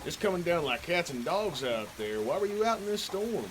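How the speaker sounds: host welcoming a soaked visitor, high and soft; folksy storm-night host. Acted. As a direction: pitch it up and hush it as the host.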